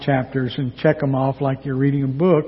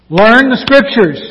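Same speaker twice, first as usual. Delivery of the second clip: louder, higher, slow preaching emphasis; raised, drawn-out command; emphatic exhortation; 6 semitones higher, 6 dB louder than usual. Retold or imitated. Preacher drives home quoted rebuke.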